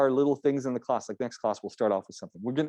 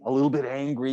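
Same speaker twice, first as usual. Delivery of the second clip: ordinary talk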